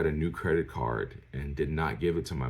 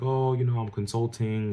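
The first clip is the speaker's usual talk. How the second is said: higher, put-on casual brush-off; airy mock excuse, pitched up; mock evasive excuse; 7 semitones higher, 3 dB louder than usual. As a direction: imitate a dad dodging the question. Dad's evasive excuse retold.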